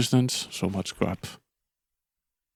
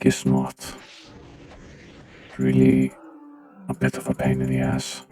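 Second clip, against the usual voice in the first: deep pitched-down robot voice; 8 semitones lower than usual. Acted. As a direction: talk like a voice-changed robot. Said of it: "Did you not like the robot voice".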